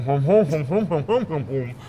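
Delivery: hummed mm-hums through a full mouth; mock chewing hums. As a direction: imitate contented hums while chewing. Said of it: "were taking these just enormous bites and going".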